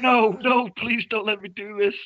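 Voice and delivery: high, loud hammed-up begging; half-comic panicked plea; mock pleading. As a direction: beg like a terrified victim. Victim begging the villain.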